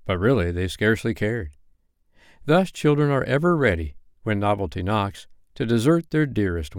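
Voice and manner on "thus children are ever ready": plain reading voice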